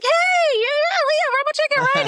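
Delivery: very high imitated hello of recognition; excited greeting. Actor recognizing her on the red carpet, imitated excited greeting.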